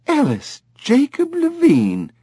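a mother calling out her son by all three names; high, slow, indignant; scolding full name. A narrator's reading.

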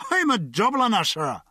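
put-on fist-shaking drunk pride; proud Dubliner drunk. A narrator's reading. Drunk proclaims he's a Dubliner, proud and tipsy. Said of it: "the drunk said".

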